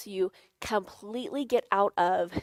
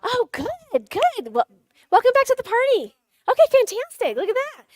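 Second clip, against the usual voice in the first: much higher, louder gushing welcome; excited welcome; 11 semitones higher, 6 dB louder than usual. Real, not acted. Welcoming volunteer Kate to the stage, gushing excitedly. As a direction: greet her like she won a prize.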